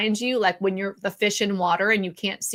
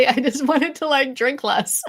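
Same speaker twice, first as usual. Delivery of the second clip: laughing, pitched up; 6 semitones higher than usual. Real, not acted. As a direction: giggle through the words. Laughing at own confession.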